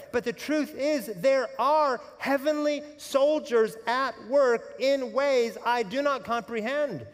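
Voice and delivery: impassioned pulpit climax; rising preaching. Acted. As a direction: build to a fervent high point.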